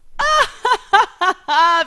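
high, loud villain's laugh; cold, high-pitched cackle; ice-cold laugh. A narrator's reading. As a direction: laugh mockingly at full volume. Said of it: "einem eiskalten Lachen".

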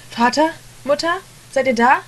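put-on a scared boy calling into an empty house; calling out anxiously. A narrator's reading. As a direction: call for your parents, frightened. Child calling for parents.

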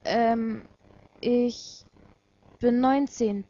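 hesitant, simple answer; plain and simple. A narrator's reading. Girl tells Watari her age, plain and simple. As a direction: answer simply, with pauses between words. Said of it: "antwortete ich ganz schlicht und einfach".

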